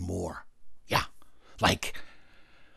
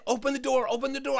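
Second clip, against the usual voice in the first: put-on high-pitched insistent child; urgent demand; 14 semitones higher, faster than usual. Girl urgently orders him.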